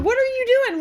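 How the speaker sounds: high-pitched, incredulous question; retold alarmed scolding. Passenger questioning driver in the turn lane, alarmed scolding. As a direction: demand an answer from the driver.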